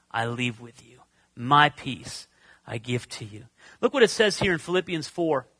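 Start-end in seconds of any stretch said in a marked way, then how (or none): 0.1-3.4 gentle promise. Acted. Quoting Jesus' promise of peace.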